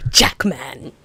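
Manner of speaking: "Jackman" drawn out, mimicking how he says it; imitated name pronunciation. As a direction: stretch out "Jackman" as he does. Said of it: "when he pronounced his own name".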